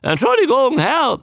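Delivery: high creature squawk; very high-pitched croak; croaking newt. A narrator's reading. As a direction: croak the greeting like a parroting newt. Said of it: "sagte die krächzende Stimme".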